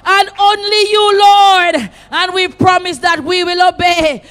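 high, loud, pleading cries of Speak and Lord; shouted preaching prayer. Acted. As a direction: cry out each Speak, Lord at full force. Repeated Speak Lord petitions.

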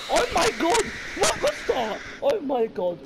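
high, loud shriek of fright; startled scream. Real, not acted. Player startled by the horror game, startled scream. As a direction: yell out in sudden panic.

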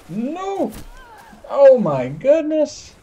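high, drawn-out cry of disbelief; shocked dismay. Real, not acted. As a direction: exclaim as if just beaten out of nowhere. Killed unexpectedly in the game.

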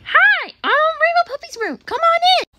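high, bouncy toy puppy voice; excited little character welcome; Rainbow Puppy. Acted. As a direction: greet brightly in a high-pitched cartoon voice.

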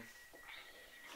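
breathy quiet laugh; soft breathy chuckle; whispered laugh. Real, not acted. After killing mob.

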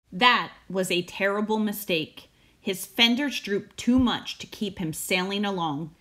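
ordinary delivery, nothing marked

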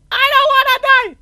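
very high, loud, quick scream; panicked scream. Real, not acted. Player begging to live in a horror game, shrieked. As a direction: scream it all out in terror.

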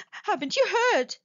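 high, urgent cry of alarm; excited cry. A narrator's reading. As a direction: blurt it out in a rush. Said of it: "Beaver cried".

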